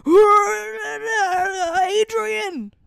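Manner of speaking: hammed-up bellow stretching into a high wail; loud weird-accented imitation. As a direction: parody shouty fighters' gibberish. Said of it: "loud talking and weird accents".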